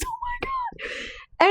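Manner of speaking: high drawn-out oh my God; squeaky exasperated. Real, not acted. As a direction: stretch it out, almost a squeak.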